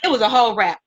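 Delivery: ordinary delivery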